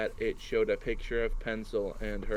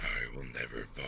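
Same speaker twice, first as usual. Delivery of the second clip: much lower, quiet, ominous voice; deep voice; 11 semitones lower, 9 dB quieter than usual. Acted. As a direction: drop deep and dark for the line. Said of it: "then she said in a deep vo-, voice".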